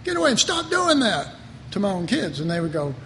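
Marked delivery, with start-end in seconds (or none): 0.0-1.3 stern shout. Retold or imitated. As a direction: call the kids to stop, sharply. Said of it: "No, I'd say".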